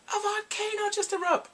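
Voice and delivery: high-pitched breaking-news outburst; imitated melodramatic exclamation; mock alarmed announcement. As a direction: cry it out high, hamming up the disaster. Acts out drama's absurd announcement.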